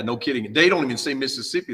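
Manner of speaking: ordinary talk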